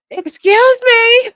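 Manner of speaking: high, loud call to a passerby. Retold or imitated. Neighbor hailing a woman from the door, calling out. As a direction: holler it high, drawn out.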